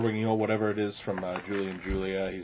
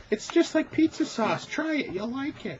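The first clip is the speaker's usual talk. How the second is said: high, sing-song coaxing mom; coaxing parent. Retold or imitated. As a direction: sweet-talk a kid into eating. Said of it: "how your parents try to".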